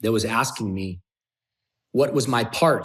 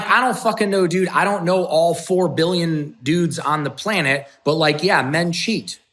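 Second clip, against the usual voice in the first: raised, louder exasperated retort; defensive arguing; 7 semitones higher, 5 dB louder than usual. Retold or imitated. Retelling himself arguing with his friend about cheating, defensive. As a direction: argue back, pitched up and forceful. Argues back when challenged by friend.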